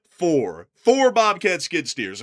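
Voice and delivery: loud and slow emphasis; emphatic. Real, not acted. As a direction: punch the number out loud. Gleeful emphasis.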